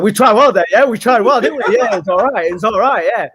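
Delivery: high, loud, breezy mock shrug; mocking the manager. Mimicking the manager excusing the loss, high breezy mock reassurance. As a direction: brush it off like a carefree boss. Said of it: "He's just gonna be like".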